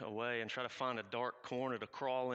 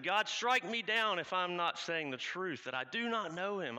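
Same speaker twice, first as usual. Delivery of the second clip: loud and higher, swearing on his life; cursing oath; 6 semitones higher, 5 dB louder than usual. Acted. Peter, swearing before the crowd, loud and desperate. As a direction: call down a curse on yourself to be believed. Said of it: "calling curses even down on myself".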